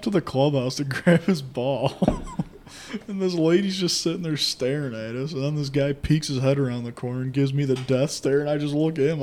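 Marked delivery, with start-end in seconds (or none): none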